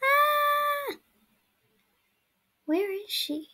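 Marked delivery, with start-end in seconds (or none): 0.0-1.0 sudden high yelp; startled yelp. Real, not acted. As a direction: yelp in fright. Startled while hiding.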